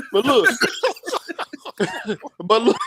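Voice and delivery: real laughter breaking up the words; laughing. Real, not acted. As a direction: try to talk through a laughing fit, high-pitched. Cracking up over his own joke.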